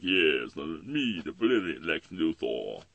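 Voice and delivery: slow, stretched supervillain voice; Lex Luthor. Acted. Cartoon villain introducing his brilliant self, slow and grandiose. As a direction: boast grandly, drawing out every word. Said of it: "That's where I got the Lex Luthor".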